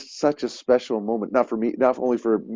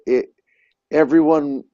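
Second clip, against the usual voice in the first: ordinary delivery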